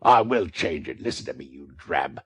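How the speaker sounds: loud menacing threats; exasperated threat. A narrator's reading. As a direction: threaten her with venom. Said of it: "exasperated beyond all bounds".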